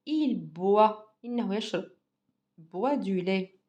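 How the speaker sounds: ordinary delivery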